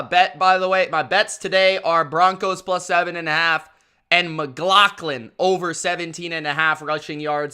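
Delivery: ordinary delivery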